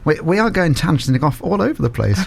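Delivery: ordinary talk, nothing marked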